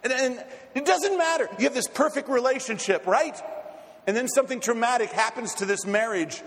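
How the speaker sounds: urgent, emphatic pulpit oratory; impassioned preaching. Real, not acted. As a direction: preach it with rising fervour, punching key words.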